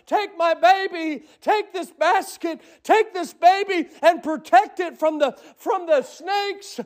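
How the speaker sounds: high, fervent plea to the Lord; mother's pleading prayer. Acted. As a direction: beg God to protect the baby. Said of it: "She's praying".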